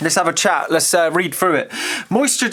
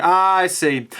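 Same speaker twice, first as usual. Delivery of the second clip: ordinary talk, nothing marked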